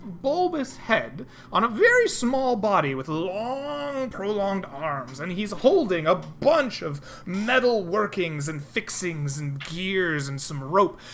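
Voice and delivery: ordinary talk, nothing marked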